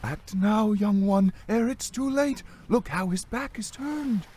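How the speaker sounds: high-pitched ghost woman; eerie, insistent female ghost, pitched high; urging spirit. A narrator's reading. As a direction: urge him on in a high spirit voice. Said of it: "he heard the spirit speak".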